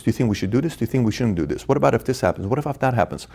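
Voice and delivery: inner monologue. Retold or imitated. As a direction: mutter it like thinking aloud. Voicing founder's inner talk.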